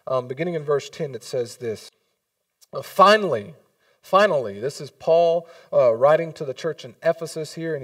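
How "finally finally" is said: emphatic reading. Acted. Reading scripture opening.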